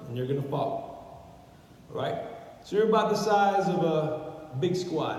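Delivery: ordinary talk, nothing marked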